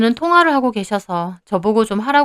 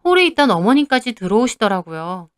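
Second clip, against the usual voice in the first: ordinary delivery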